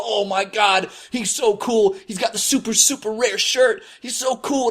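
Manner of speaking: mock-awed onlookers cooing over a rare shirt; admiring onlookers. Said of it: "and they'd go".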